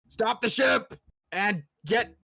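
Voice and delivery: high, loud barked order; a captain yelling across the deck; captain's shout. Acted. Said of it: "He is going to say".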